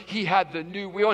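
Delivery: usual speaking voice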